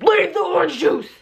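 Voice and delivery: high sharp command from mom; mom yelling. Retold or imitated. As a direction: snap at the kid to drop the juice. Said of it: "literally yelled at me".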